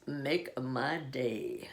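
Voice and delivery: low, soft, very slow tough-guy line; quiet, drawn-out movie-hero menace; Clint Eastwood. Acted. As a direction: drop the pitch and stretch every word. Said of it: "you've got the Clint Eastwood".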